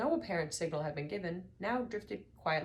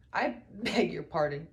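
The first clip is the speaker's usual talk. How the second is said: spluttering; 7 dB louder, slower than usual. A narrator's reading. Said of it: "he spluttered".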